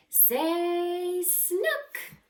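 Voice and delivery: high, cheery photographer call. Acted. Photographer asking someone to smile, high and cheery. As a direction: coax a smile for the camera.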